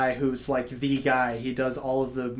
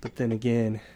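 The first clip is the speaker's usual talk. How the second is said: sultry voice; 5 semitones lower than usual. Pretend. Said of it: "sultry voice".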